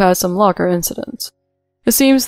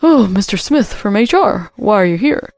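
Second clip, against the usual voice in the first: louder, flustered surprise; startled gasp; 5 dB louder than usual. A narrator's reading. Manager seeing the head of HR walk in, startled. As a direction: greet an unexpected superior in alarm. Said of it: "The manager gasped".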